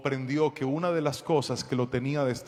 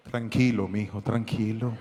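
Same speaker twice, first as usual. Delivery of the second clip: gentle pastor; 6 semitones lower, 3 dB quieter than usual. Retold or imitated. Pastor calming the crying young man, soft low reassurance. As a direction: lower and softer, calming. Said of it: "me transformé en pastor".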